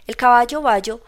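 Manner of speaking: calls out loud to the crowd; boastful shout from the cart; shouting loudly. Acted. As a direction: bellow it across the square. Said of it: "dice a grandes voces".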